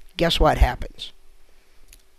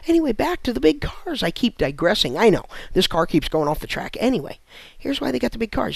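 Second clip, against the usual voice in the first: usual speaking voice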